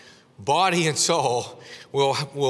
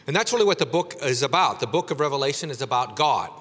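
ordinary talk, nothing marked

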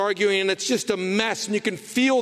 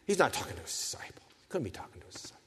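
hushed aside; 5 semitones lower, 10 dB quieter, much faster than usual. Real, not acted. Sermon aside dropping to hush.

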